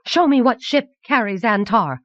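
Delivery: high-pitched, insistent demand; urgent cry. A narrator's reading. Anagel demands the talisman show the ship, urgent cry. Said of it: "cried Anagel".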